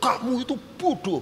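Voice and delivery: high-pitched mock scolding; insulting intonation. Shown as a demonstration. Preacher shows intonation that makes a person angry, high harsh insult. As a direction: say it so the listener takes offence. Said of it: "pasti orangnya marah".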